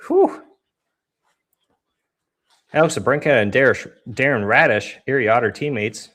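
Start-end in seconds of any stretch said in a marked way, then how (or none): 0.1-0.4 high breathy exhale after running; winded whew. Real, not acted. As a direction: blow out a tired whew. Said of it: "I'm out of breath".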